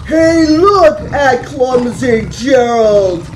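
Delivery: roaring lions. Acted. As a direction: jeer like a pack of big lions. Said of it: "they soon began to roar".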